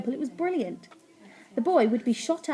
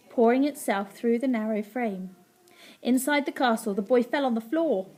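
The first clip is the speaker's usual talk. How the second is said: usual speaking voice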